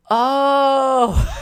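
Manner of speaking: loud, high, drawn-out "oh"; long, high yelp of sudden understanding; mock realization. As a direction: belt it out as a big dumb realization. Acts out realizing the card changed.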